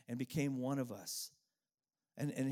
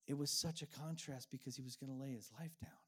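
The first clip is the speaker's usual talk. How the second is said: hushed whisper; 5 dB quieter than usual. Real, not acted. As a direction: drop to a whisper, softer. Solemn turn in sermon.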